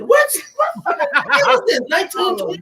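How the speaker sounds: very high, incredulous squawk; shocked disbelief. Acted. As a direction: react as if scandalized, voice leaping up. Comic shock at the word quoted.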